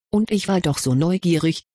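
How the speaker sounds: ordinary delivery, nothing marked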